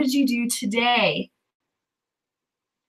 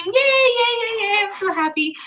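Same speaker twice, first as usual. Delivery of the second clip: excited; 9 semitones higher than usual. Real, not acted. Cheering a viewer's stream total.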